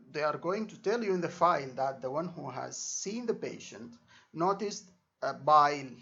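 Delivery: normal talking voice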